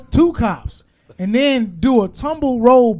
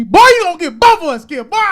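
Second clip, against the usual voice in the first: higher and louder warning to a friend; scolding shout; 10 semitones higher, 7 dB louder than usual. Pretend. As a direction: yell at him to stop before it is too late. Scolding passenger who talks back.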